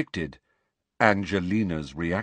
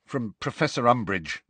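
ordinary delivery, nothing marked

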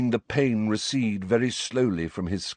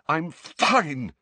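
higher, forced-out words; choked; 6 semitones higher than usual. A narrator's reading. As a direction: insist through a choking throat. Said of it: "Harry choked".

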